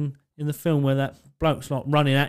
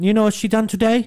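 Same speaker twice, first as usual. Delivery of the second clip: lightly voiced eager shop worker; higher, eager gossip; gossiping shop bloke, pitched up; 9 semitones higher, 4 dB louder than usual. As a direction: kebab man telling tales, higher and eager. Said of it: "And he went".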